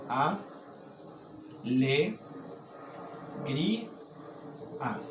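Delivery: A, le, gri, a split into separate beats; syllable by syllable. Shown as a demonstration. Teacher dividing a word into syllables for students, slow and split. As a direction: spell out alegría one syllable at a time. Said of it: "Cuatro sílabas".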